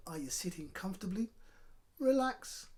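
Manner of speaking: ordinary delivery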